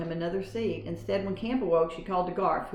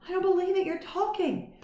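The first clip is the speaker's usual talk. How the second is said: high, astonished disbelief; amazed boy; 13 semitones higher than usual. A narrator's reading. Boy amazed that his mouse talks. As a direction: gasp it out in wonder.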